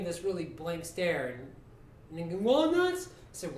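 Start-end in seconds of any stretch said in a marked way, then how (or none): 2.4-3.1 high-pitched, loud echoed question; puzzled kid. Retold or imitated. Neighbour kid answers the man's question, puzzled. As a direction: imitate a baffled kid, voice jumping up. Kid's puzzled reply after blank stare.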